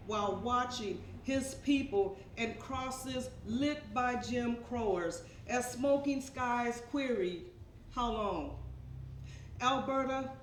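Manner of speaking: measured poem reading with deliberate pauses; poetry recitation. Acted. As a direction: recite line by line, weighting key words. Recites her second poem.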